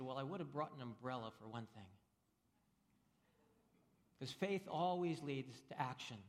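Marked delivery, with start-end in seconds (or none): 0.0-1.9 dry punchline. Retold or imitated. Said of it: "And he said".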